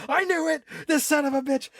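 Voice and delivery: guy who knew it all along, playfully outraged; mock fury. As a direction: blow up with fake jealous rage. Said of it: "says too much".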